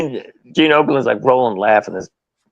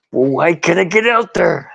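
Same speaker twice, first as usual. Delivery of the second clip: higher, put-out wrestler voice; lightly acted indignant wrestler; Macho Man impression; 6 semitones higher than usual. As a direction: demand an answer, pitched up. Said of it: "Macho Man story".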